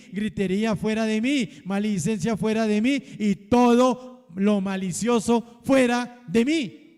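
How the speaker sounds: loud, building declaration, each line punched; preaching at full force. Acted. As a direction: lead the church in a rising declaration. Congregation repeats a declaration. (predicación fervorosa, cada vez más fuerte)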